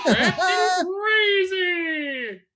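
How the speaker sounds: high loud laughing cry; laughing shout. Real, not acted. Co-host laughing loudly after the jingle. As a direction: shriek it through laughter.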